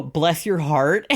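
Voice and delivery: slow Southern drawl, rising at the end; sweetly hammed-up Southern; Southern accent. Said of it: "Southern accent".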